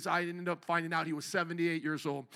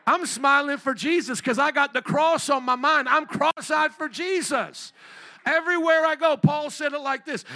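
fiery call and response; 6 semitones higher, 6 dB louder than usual. Acted. Pastor leading the crowd to shout Chicago for Jesus, loud. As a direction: make them shout it back.

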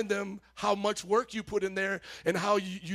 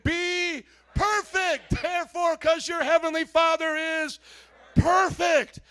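higher, very slow shouted scripture; shouted verse; 7 semitones higher, 7 dB louder, slower than usual. Shown as a demonstration. Pastor shouts the verse with the church, high and loud. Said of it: "we're gonna shout that again".

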